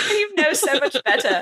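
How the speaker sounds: high mock British scolding, laughing; hammed-up British reproach through giggles; fake British accent. Host echoing the friend's scolding, fake British accent. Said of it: "I'm gonna be doing it this whole episode".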